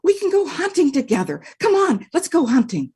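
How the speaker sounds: high, thrilled voice; excited man; delighted man. Acted. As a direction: welcome the dog with joy. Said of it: "Man said".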